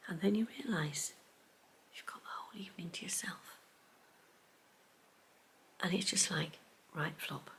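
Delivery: soft, hushed confession; whisper. Real, not acted. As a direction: whisper it like a secret. Lazy evening confession.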